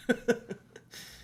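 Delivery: short burst of real laughter. Real, not acted. Vlogger joking to British viewers about accents, laughing. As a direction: laugh at your own joke. Laughs at own American remark.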